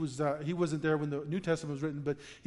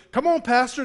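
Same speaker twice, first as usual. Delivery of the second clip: calling out; 5 semitones higher, 7 dB louder than usual. Retold or imitated. Friend calls pastor onto ice.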